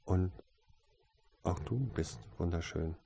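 soft, subdued compliment; hushed and mildly sheepish; abashed. A narrator's reading. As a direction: add it quietly, a little embarrassed. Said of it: "sagte er betreten".

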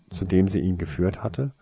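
plain reading voice

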